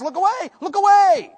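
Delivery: loud, high, rapid-fire commands; urgent fatherly warning. Acted. Father telling his sons to avert their eyes, urgent shouting. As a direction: shout it like an alarm to the boys. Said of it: "So I'm telling my sons".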